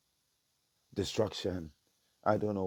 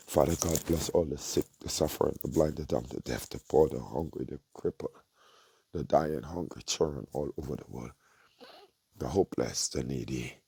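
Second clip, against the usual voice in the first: fervent prayer; 3 semitones lower than usual. Real, not acted. Preacher praying aloud for the suffering, fervent. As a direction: call out each group to bless with fervour.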